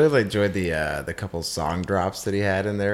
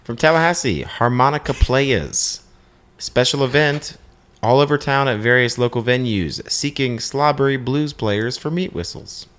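higher, stretched announcer-like delivery; theatrical ad read; 4 semitones higher than usual. Acted. Host reading a harmonica players' ad, grandly announced. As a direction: proclaim it grandly, pausing between phrases.

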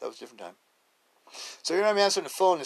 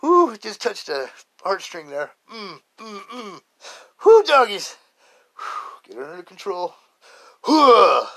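moved; 7 semitones higher, 9 dB louder, slower than usual. Real, not acted. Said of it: "Get my composure again".

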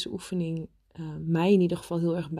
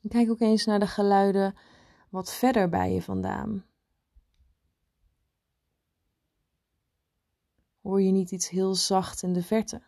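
guided meditation. Acted. Leads a meditation.

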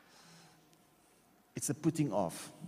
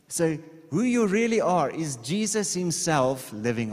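preaching loudly; 6 semitones higher, 6 dB louder than usual. Real, not acted. Preaching climax on identity.

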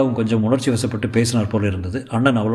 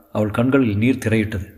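plain reading voice

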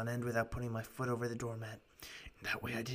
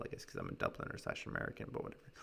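usual speaking voice

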